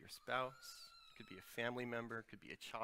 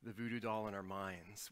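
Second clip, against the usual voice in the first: ordinary talk, nothing marked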